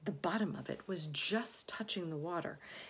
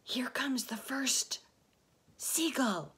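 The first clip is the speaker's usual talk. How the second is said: whispered; 5 semitones higher, 5 dB louder than usual. A narrator's reading. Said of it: "whispered James".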